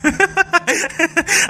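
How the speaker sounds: high-pitched burst of cackling; mocking laugh. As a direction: cackle at her plight. Laughs at her torn sari. (ऊँची आवाज में ठहाका)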